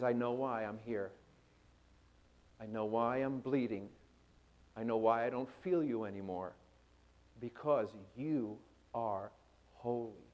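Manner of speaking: low, soft, hushed realization; quiet resolve. Acted. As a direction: let it sink down to a near whisper. Jesus finally answers, voiced low.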